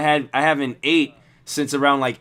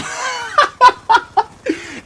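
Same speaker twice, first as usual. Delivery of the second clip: real laugh breaking out high; high burst of laughter; 5 dB louder than usual. Real, not acted. Laughing at the bum's outburst, high real laugh. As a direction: crack up remembering it. Narrator cracks up at memory.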